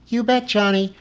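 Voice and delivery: high, eager beast voice; a lightly voiced eager pet; Lummox voiced. A narrator's reading. Lummox answering Johnny about rocks, high eager.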